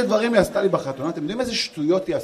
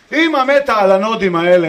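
put-on louder, gushing mother cooing at the baby; wife's baby talk; 3 semitones higher, 10 dB louder than usual. Wife gushing over the baby's farts, loud cooing. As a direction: coo over the baby like a doting mom. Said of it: "אשתי קמה".

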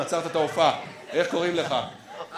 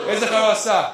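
calling out to the crowd; 4 semitones higher, 4 dB louder than usual. Real, not acted. Hollers question to audience rows.

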